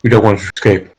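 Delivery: low, controlled commander's voice; villain impression. Villain ordering troops to guard her, low commanding impression. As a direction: do a film villain giving orders. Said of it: "disturbingly accurate impression".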